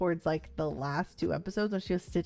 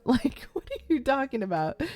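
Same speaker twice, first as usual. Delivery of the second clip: laughing disbelief; 7 semitones higher, 4 dB louder than usual. Real, not acted. Laughing at Fisk's restaurant line.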